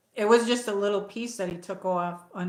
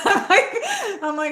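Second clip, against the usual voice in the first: laughing; 13 semitones higher, 8 dB louder than usual. Real, not acted. Laughing about only fifty bucks.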